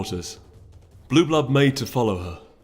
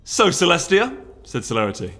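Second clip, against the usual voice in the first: higher and louder, pressing hard; heated challenge; 11 semitones higher, 7 dB louder than usual. Acted. Duchess pressing the princess to choose sides, heated. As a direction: demand an answer over the noise.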